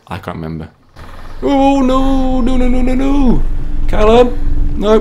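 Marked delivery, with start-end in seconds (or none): 1.4-5.0 high, loud panicked screaming; screaming in fear. Real, not acted. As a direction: shriek as the ride drops. Ride turns scary, screams.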